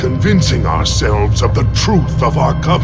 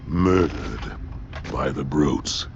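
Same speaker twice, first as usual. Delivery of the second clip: low, soft report of a killing; grim, hushed; 10 semitones lower, 9 dB quieter than usual. Acted. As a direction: break grave news quietly and low. Reporting slain counselors to ally.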